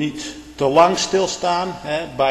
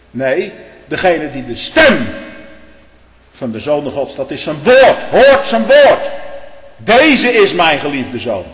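slow, high, loud pulpit cry; fiery preacher's climax; preaching at full force; 6 semitones higher, 11 dB louder, slower than usual. Acted. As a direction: thunder it out to the congregation. Preaching climax, much louder.

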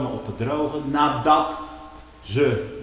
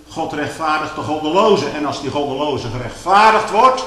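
loud, higher fervent pulpit preaching; preaching at full force; 6 semitones higher, 7 dB louder than usual. Acted. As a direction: lift the congregation with rising fervour. (luide, vurige preek)

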